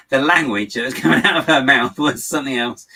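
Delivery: amused. Real, not acted. Telling viewers about a friend's hilarious swearing, amused and laughing. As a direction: tell it loudly through laughter.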